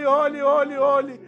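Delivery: usual speaking voice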